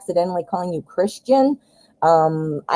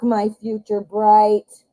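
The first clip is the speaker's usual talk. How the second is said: normal talking voice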